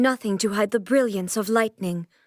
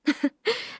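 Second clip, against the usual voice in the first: soft amused laugh; 8 semitones higher, 4 dB quieter than usual. Acted. Amused at shooting stars.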